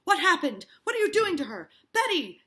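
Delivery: high, very loud alarmed demands; panicked shouting. Acted. Parris rushes in, acted.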